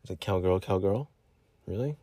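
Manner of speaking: softly checking, puzzled; hushed aside. Acted. Aside to the parents again.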